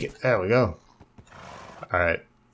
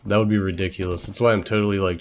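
ordinary talk, nothing marked

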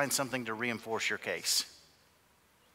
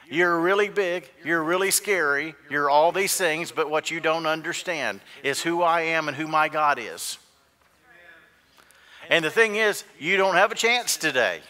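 raised, loud challenge to a giant; bold defiance; 4 semitones higher, 8 dB louder than usual. Acted. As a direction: stand up to the enemy, loud and sure. Acting out David defying Goliath.

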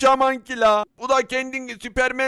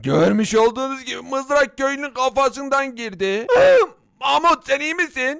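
usual speaking voice

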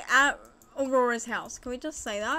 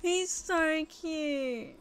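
very slow, drawn-out adoring coo; slow tender gush; 4 semitones higher, much slower than usual. Real, not acted. Gushing over baby sim.

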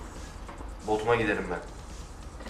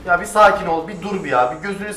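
loud exasperated plea; 6 semitones higher, 8 dB louder than usual. Real, not acted. Calming the vehicle loudly.

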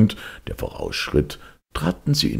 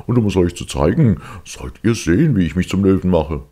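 loud, fast, higher boasting ogre; gruff ogre; 5 semitones higher, 5 dB louder than usual. Acted. Ogre boasts to the cat, loud and fast. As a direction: show off with brute pride. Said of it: "antwortete der Oger barsch".

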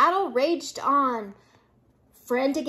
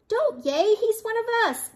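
high, scolding yell; 9 semitones higher than usual. A narrator's reading. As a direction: shout the crowd down, pitched up. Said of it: "yelled Pip".

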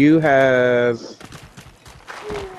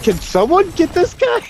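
panicked high plea; 13 semitones higher than usual. Retold or imitated. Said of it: "I was like".

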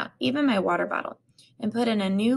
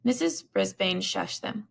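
usual speaking voice

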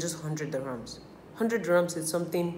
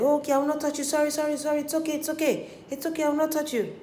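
higher, placating apology voice; mock pleading driver; 10 semitones higher, faster than usual. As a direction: plead and soothe like a guilty man. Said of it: "then the guy said".

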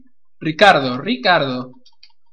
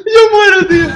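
crying with laughter; 14 semitones higher, 9 dB louder than usual. Real, not acted. Dying of laughter.

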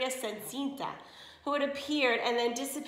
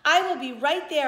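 called out loudly, slightly higher; calling back; 4 semitones higher, 8 dB louder than usual. Acted. Maid calls back to the demanding sisters, loud. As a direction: call it up the stairs.

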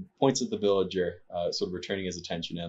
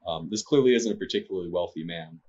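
normal talking voice

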